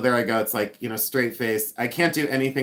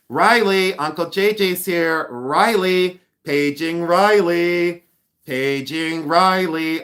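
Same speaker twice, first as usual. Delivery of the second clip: loud, higher-pitched paging call; playful calling out; 5 semitones higher, 6 dB louder, slower than usual. Pretend. Uncle J J paging Missy's dog Riley, playfully calling out. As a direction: call the dog with drawn-out names.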